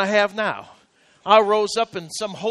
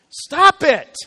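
louder and higher, snapped; sharp command; 7 semitones higher, 7 dB louder than usual. Shown as a demonstration. Preacher snapping at the congregation, loud. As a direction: stop it, barked out. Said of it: "commanding congregation".

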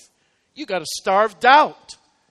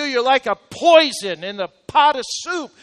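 ordinary delivery

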